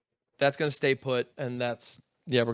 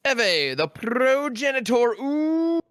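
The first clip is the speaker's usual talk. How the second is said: dramatic announcement; 9 semitones higher, 4 dB louder, much slower than usual. Real, not acted. Announcing big creature cast.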